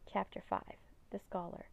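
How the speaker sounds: ordinary delivery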